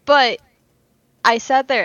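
ordinary talk, nothing marked

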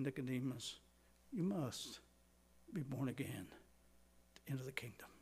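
soft, hushed "Nicodemus, you must be born again", fading to a whisper; tender appeal. Shown as a demonstration. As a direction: plead gently, almost whispering. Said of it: "I think he was saying".

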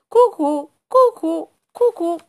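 high, loud "cucù, cucù" sung like a cuckoo; teaching the dog: "cucù" in a high bird voice; cuckoo call. Shown as a demonstration. Cuckoo teaching the dog, high sung call. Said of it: "Ascolta bene come faccio".

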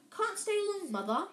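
pompous prefect. Acted.

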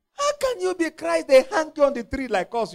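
ordinary talk, nothing marked

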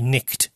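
"nicked" for night, short i and sounded k; Middle English pronunciation. Shown as a demonstration. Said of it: "the pronunciation should be".

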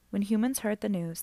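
ordinary delivery

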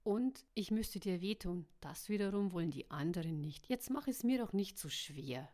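ordinary delivery

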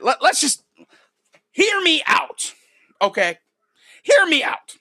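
high-pitched hear me out; high pleading. Real, not acted. Pleading with listeners to listen.

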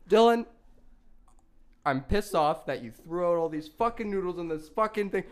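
loud, high, laughing mock fury; hammed-up angry lecture, breaking into laughter; retold dad rant. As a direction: rant like a dad who can't believe it.

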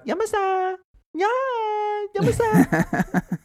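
Yamasa! shouted very high and stretched, with a laugh; exuberant cheer. Acted. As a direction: cheer out Yamasa like a jubilant cry. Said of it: "You go".

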